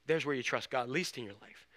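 ordinary talk

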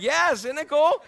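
slow delighted brag; proud yes. Retold or imitated. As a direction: savor the cool of it. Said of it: "I'm like".

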